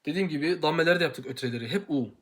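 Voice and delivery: normal talking voice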